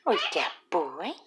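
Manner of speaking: usual speaking voice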